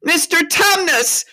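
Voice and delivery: high, loud, drawn-out shout; piercing exasperated yell; bawling. A narrator's reading. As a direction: bawl it right in his ear. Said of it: "bawled Lucy in his ear".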